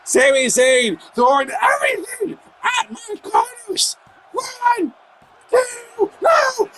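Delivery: high, shouted play-by-play; wrestling commentator. Real, not acted. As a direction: call every move at fever pitch. Live play-by-play at full hype.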